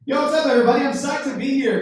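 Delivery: loud, high hype to open the set; pumped up opening hello; hyped greeting. Real, not acted. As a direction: burst on stage buzzing with energy. Comic greets crowd.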